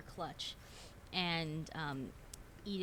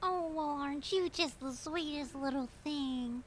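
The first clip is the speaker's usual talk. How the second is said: put-on high, sugary little-old-lady voice; sweet old lady; 8 semitones higher, 4 dB louder than usual. [name] fawning over the waiter, sugary. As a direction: coo it like a doting grandmother.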